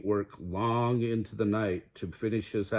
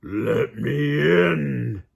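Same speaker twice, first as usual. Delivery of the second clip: very slow, louder wolf voice; wolf demanding; 4 semitones higher, 6 dB louder, much slower than usual. A narrator's reading. Wolf at the brick house, slow and loud. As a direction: drag out each word at the door.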